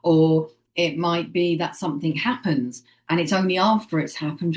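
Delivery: ordinary talk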